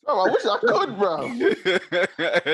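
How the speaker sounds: laughing high and loud; words through real laughter. Real, not acted. Laughing at lagging caller.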